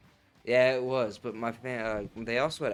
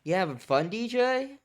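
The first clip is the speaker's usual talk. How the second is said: louder, higher teasing call to a friend; 4 semitones higher, 9 dB louder than usual. Real, not acted. Streamer calls out to his friend DJ playing the tournament, loud teasing. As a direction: shout across the room to get DJ's attention.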